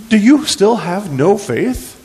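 slow, high-pitched reproach; incredulous challenge. Acted. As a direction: press the disciples with drawn-out disbelief. Acts Jesus challenging the disciples.

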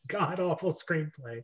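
breathy whispered aside through a laugh; conspiratorial whispered jab. Real, not acted. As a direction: hiss the insult under your breath, amused. Mocking the script with friends.

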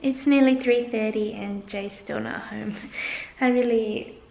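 ordinary talk

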